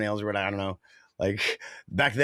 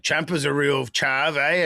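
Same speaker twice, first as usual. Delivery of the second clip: mock British accent; 3 semitones higher, 4 dB louder, slower than usual. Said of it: "British or Australian".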